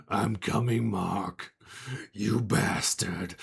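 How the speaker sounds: drawn-out monster threat; menacing jar creature. Acted. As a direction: growl it slowly, like a thing trapped in a jar. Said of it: "from inside the, the jar, you just hear".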